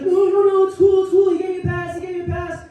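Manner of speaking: Brian panicking. Retold or imitated. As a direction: scramble to explain yourself. Brian panicking defends himself.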